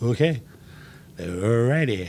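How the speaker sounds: low, soft, retold bewildered assent; stunned acceptance. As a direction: give in quietly, at a loss for words. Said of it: "I was just like".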